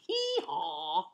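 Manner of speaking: drawn-out imitated hee-haw; donkey bray. Donkey's bray in read-aloud story.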